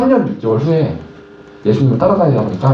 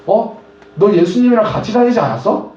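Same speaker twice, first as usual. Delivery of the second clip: put-on a higher, surprised girl's voice accusing Peter; lightly voiced girl, pitched up and questioning; servant girl asking; 7 semitones higher than usual. (높은 목소리로 놀라 묻는 여자아이)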